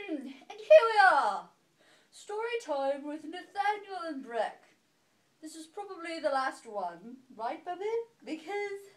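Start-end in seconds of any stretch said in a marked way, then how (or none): 0.1-4.6 drawn-out showy announcement; slow, loud ta-da opening; grand opening. Real, not acted. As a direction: open the show with a flourish. Announcing start of story time.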